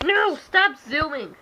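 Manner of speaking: high, loud cry of no, then slow; loud protest. Real, not acted. Telling her friend to stop zooming, loud and high.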